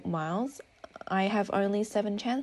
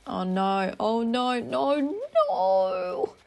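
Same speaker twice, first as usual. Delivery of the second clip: dismayed; 4 semitones higher, 5 dB louder, much slower than usual. Real, not acted. Dismayed at an unwanted villager.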